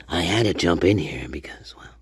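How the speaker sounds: whisper. Real, not acted. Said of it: "whispering aside".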